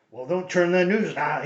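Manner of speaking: lightly voiced radio host; a hint of a fifties radio announcer; old radio announcer. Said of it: "it used to be, "I'm Richard Fox".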